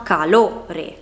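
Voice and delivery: calore stressed on LO, ca-LÒ-re; stress on middle syllable. Shown as a demonstration. Teacher showing the stronger syllable in calore, LO stressed. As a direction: lean on the LO of calore. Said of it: "viene pronunciata con più intensità".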